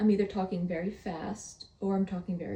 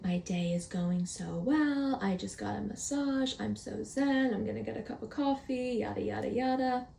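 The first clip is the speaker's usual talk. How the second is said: relaxed voice memo; 4 dB quieter than usual. Retold or imitated. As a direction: say it zen, floating out of a massage. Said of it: "You're all relaxed. Your voice, it's relaxed".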